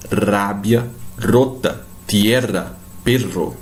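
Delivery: "Rábia, rota, tierra, perro" with hard trilled R; strong Spanish R. Shown as a demonstration. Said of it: "um pouco mais de força". ("Rábia, rota, tierra, perro" com R forte e vibrado)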